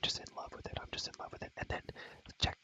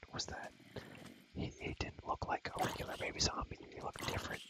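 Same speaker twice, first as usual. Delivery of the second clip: ordinary talk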